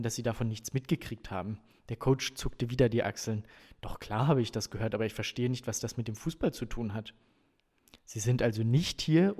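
animated audiobook reading with lively dialogue; story narration. A narrator's reading. Reader performs coach's visit to the family, story narration. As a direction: read out the scene with emphatic dialogue. Reads novel passage aloud.

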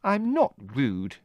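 high, slow indignant sister; angry denial. A narrator's reading. Margot denying she is rude, angry. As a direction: snap back in offended protest. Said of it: "said Margot angrily".

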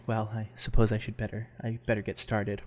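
low, soft, halting character voice; hesitant festival founder. Acted. Festival founder to the waiting crowd, hesitant and quiet. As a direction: ease reluctantly into it, quietly.

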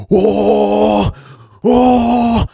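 high strained mock grunts; mock strained groan. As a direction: squeak it out like straining. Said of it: "he's like".

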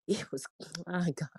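real emotion, voice sinking low and soft; choked up, words falling away quietly; overcome, barely getting it out; getting emotional. Real, not acted. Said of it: "I'm getting emotional".

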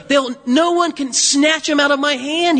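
usual speaking voice

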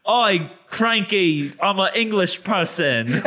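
slow, high, hammed-up British accent; mock British accent. Showing the host an English accent, badly hammed up. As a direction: do a silly cartoon Brit. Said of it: "do a British accent".

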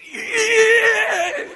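very high, loud, drawn-out yell; imitated little boy's gleeful shriek; excited toddler squeal. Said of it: "he gets all excited about him".

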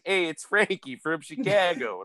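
put-on slow, drawn-out Chicago accent. Friend imitating his Chicago buddy, put-on Chicago accent. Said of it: "if I did that with any other accent".

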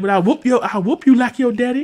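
high, loud mock threat; Bernie quote. Retelling Bernie threatening the kids, high and loud. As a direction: bark the threat like a fed-up uncle. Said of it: "It's like".

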